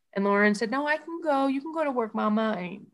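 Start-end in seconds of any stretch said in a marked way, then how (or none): 0.7-2.5 daughter's voice. Retold or imitated. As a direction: imitate a little girl reassuring her mother. Voices daughter's reassuring reply.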